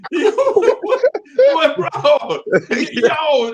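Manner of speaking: laughing. Real, not acted. Howling at [name] joke.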